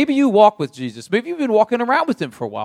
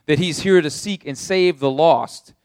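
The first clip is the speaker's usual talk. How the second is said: ordinary delivery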